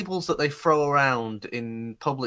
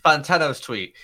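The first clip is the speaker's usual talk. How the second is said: ordinary talk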